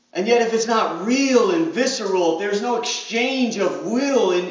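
normal talking voice

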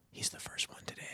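imitated gossipy whisper. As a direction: whisper it like a bystander commenting on someone. Helpers whispering, overheard at self checkout.

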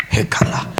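very loud, slow nonsense prayer; loud mock tongues. Pastor mocking a shouting prophet, very loud. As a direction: bellow fake tongues.

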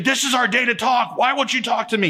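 imitating his irritated girlfriend on the phone; frustrated wife. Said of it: "She's like".

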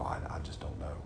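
a small defeated murmur; soft and hopeless; hushed helpless admission. Acted. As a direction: drop almost to nothing, lost. Pastor acts out doubtful prayer.

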